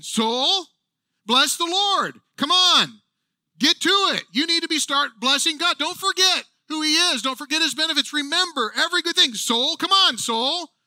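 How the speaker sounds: David rousing his soul, pushing hard; fired-up self-exhortation. Retold or imitated. Preacher voicing David urging his own soul, fired up. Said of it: "He's basically saying".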